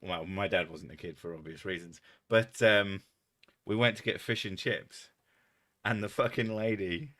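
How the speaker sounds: usual speaking voice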